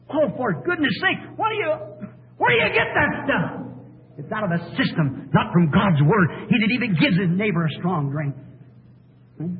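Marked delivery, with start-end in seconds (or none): 0.1-3.5 high-pitched, indignant rebuke; incredulous preacher's outburst; exasperated. Real, not acted. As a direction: challenge them in exasperation, pitch leaping up. Preacher rebukes modern drinking in churches.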